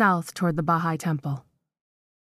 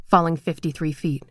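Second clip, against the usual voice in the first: ordinary delivery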